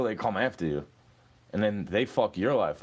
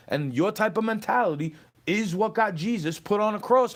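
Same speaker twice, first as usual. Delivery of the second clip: heated, high-pitched scolding; angry rant; 8 semitones higher than usual. Real, not acted. Berating an online troll, angry and high. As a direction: lay into them, voice raised in pitch.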